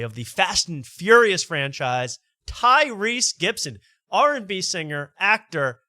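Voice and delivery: slow, drawn-out, high announcer build-up to the name; host hypes the star guest to his co-host, drawn-out announcer call; hyped announcer intro. Pretend. Hyping a big guest intro.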